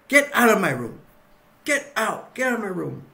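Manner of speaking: high, loud mock-angry command; acted angry order. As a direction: throw them out in a hammed-up temper. Herbert orders intruder out.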